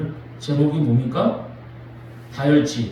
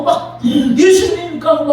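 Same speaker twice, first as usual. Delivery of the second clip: high, loud joyful cry of Jesus's name; an excited shout across the water; calling out; 8 semitones higher, 4 dB louder, much slower than usual. Retold or imitated. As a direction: call out as if running to him.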